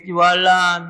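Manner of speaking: ordinary talk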